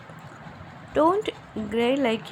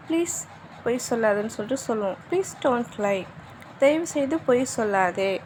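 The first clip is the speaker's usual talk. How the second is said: usual speaking voice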